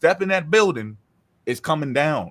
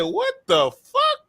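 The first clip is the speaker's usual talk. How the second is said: much higher, slow, stretched-out disbelief; retold shock; much slower than usual. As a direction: drag it out, stunned. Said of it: "I said".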